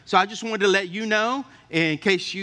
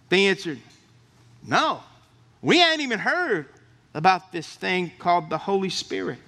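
scripture reading; 2 semitones higher than usual. Acted. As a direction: read the verse out to the congregation. Reads Acts scripture aloud.

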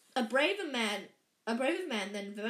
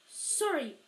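higher-pitched, quick apology; a quick sorry, pitched up; 5 semitones higher than usual. A narrator's reading. Harry apologising to the shocked giant, said quickly. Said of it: "Harry said quickly".

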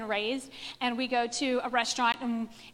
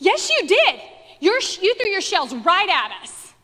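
much higher and louder, a hammed-up furious retort; angry insistence; 6 semitones higher, 7 dB louder than usual. Angry man insisting the girls threw shells at him, loud and furious. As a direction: fire it back like an offended stranger.

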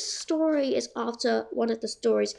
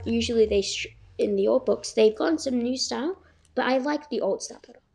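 plain reading voice

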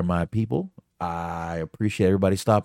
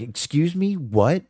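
mock-startled, pitched high; taken aback; 9 semitones higher, slower than usual. Reading Lufisto's reply to the French Canadian insult, taken aback. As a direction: read out an incredulous reply, voice lifted.

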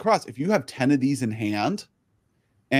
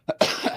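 short rough cough into the mic; coughing fit. Real, not acted. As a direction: cough once, hard. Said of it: "Sorry I'm coughing so much".

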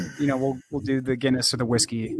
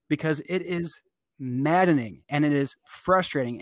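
ordinary talk, nothing marked

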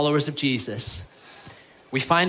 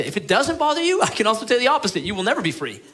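rising warning; 8 semitones higher, 3 dB louder, faster than usual. Acted. Preacher warns the unbothered.

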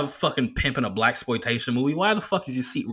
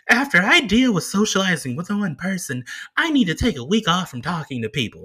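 high mock-precious complaint; goofy internet-meme voice; 7 semitones higher than usual. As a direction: mimic a self-pitying social media post. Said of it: "sound like the, these fucking goofy internet memes".

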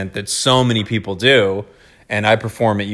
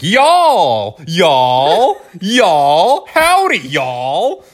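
much higher, loud hammed-up Southern yall greeting; mock Southern drawl; 7 semitones higher, 5 dB louder, much slower than usual. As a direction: play a tourist overdoing a Southern accent. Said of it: "someone walking around, you know, Cincinnati from another country being like".

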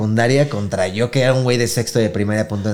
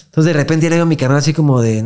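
ordinary talk, nothing marked

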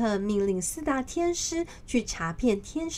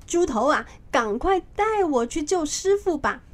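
louder, higher, urgent command; furious urgency; 5 semitones higher, 4 dB louder than usual. A narrator's reading. Monkey King, enraged, orders Pigsy to lead him to the master. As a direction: push him out the door in a rage. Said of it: "顿时怒不可遏".

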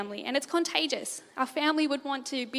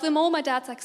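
usual speaking voice

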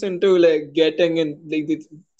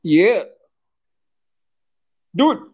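ordinary delivery

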